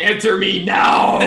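hammed-up command. Waiting for the new poll to open, hammed-up shout. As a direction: bellow it dramatically, drawing out the last word.

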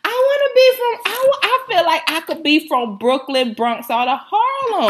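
put-on New York accent. She fakes a New York voice for her friends, lightly. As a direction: play a wannabe Brooklyn girl, lightly New York. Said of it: "you gonna hear that accent".

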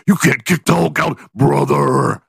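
hammed-up wrestler promo; Hulk Hogan voice. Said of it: "And I'll be like".

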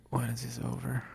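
tired mumble. Retold or imitated. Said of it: "I was like".

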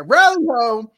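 loud, high mock greeting; hearty call. Teller signs off the scene, loud high call. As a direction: belt it out high and quick.

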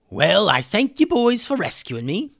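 high, loud put-on voice; high-pitched storybook figure; grateful cartoon character. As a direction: thank them in a high character voice. Rescued Hummus thanks the boys.

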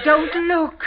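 high, loud and slow through tears; a distraught cry; weeping. Acted. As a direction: plead through sobs. Mother and daughter weeping.

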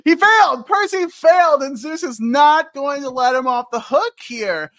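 loud, high mock outrage; high-energy, punched-out storytelling; animated recap. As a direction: exclaim it like a dramatic recapper. Drops impression, mock-dramatic recap.

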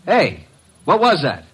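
loud, high alarmed calls into the receiver; shouting into the phone. Acted. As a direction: yell down the line for him. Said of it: "[name], stop shouting".

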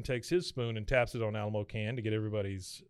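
ordinary talk

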